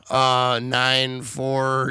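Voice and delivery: slow movie quote. Retold or imitated. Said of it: "He's like".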